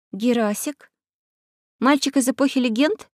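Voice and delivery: put-on surprised girl. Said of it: "удивилась Алиса".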